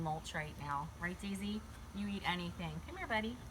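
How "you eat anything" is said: ordinary delivery, nothing marked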